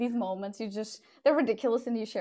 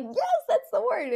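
excited; 13 semitones higher, slower than usual. Retold or imitated. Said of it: "And I was like".

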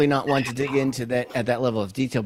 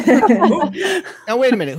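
laughing; 12 semitones higher, 8 dB louder than usual. Real, not acted. Laughs after sarcasm joke.

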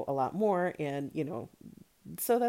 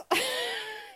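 high nervous giggle; a quick embarrassed giggle; laugh. Real, not acted. Admitting she struggles with accents, nervous giggle.

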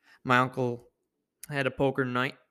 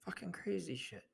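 hushed whispered aside; whispering in disbelief about the stolen cars; 4 semitones higher, 9 dB quieter than usual. Real, not acted. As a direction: breathe it out under your breath. Aside after telling the theft.

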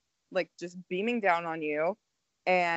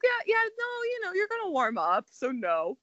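high sugary reassurance; 12 semitones higher than usual. Retold or imitated. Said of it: "she's like".

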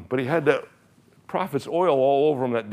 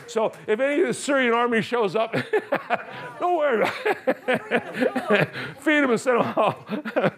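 high, laughing through the words; 7 semitones higher than usual. Real, not acted.

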